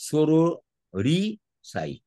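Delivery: "sororicide" very slow, split as soro, ri, cide; syllable by syllable. Shown as a demonstration. Teacher showing students the three syllables, "sororicide" said syllable by syllable. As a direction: break "sororicide" into three drawn-out parts with pauses. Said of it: "তিনটে syllable আছে".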